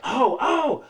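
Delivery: high, chirpy welcoming voice; eager guard. Retold or imitated. Security guard suddenly waving her through, eager. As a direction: wave her through cheerfully. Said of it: "And they're like".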